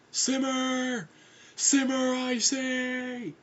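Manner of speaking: high, long-held yell; calling after them. Acted. Foyle running after the screaming pair, high hollered order. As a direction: holler after runaways, holding each word.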